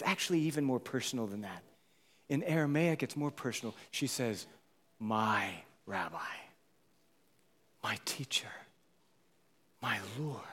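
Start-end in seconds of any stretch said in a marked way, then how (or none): 5.0-10.4 Mary whispering to her teacher in awe; whispered devotion. Retold or imitated. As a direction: breathe it out intimately. Mary's intimate recognition.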